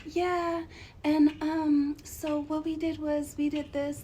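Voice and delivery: a light, gentle imitation of a sweet, soft-spoken woman; soft-spoken petite voice. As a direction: speak small and softly, like a shy young woman. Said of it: "her voice is like".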